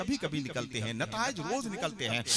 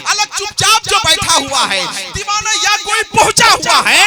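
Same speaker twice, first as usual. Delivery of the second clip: much louder and higher, ending in a shout; shouted recitation; 8 semitones higher, 17 dB louder than usual. Acted. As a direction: hurl the verse at the hall. Couplet shouted to crowd. (चीखकर शेर पढ़ना)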